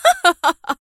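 high, cruel mocking laugh; savage mocking laugh. A narrator's reading. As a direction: laugh at him like a savage villainess. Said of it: "أطلقت ضحكة وحشية ساخرة". (ضحكة وحشية ساخرة)

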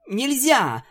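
high ringing panicked shout. A narrator's reading. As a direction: shout her off in a fluster. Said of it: "звонко кричала Пегаска".

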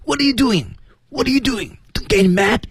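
very high squeezed squeak; timid, worried warning. Shown as a demonstration. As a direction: squeeze it out as if scared to be heard. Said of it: "一定要很胆怯，很担心啊".

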